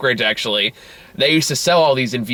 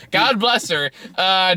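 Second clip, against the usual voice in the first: ordinary talk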